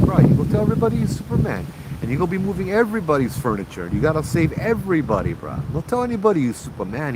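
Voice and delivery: high, quick retold warning; animated admonishing. Nurse cautioning a patient who brags he's Superman, animated admonishing.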